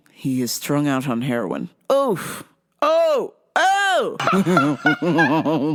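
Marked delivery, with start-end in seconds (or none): none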